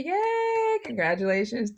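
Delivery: high, loud drawn-out cheer; celebratory shout. Real, not acted. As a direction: shout a big congratulatory yay. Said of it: "celebrate and shout and say".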